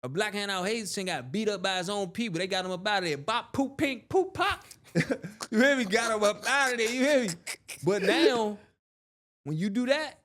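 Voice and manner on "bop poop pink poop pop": mock punch sounds. Mimics the sound of a beating.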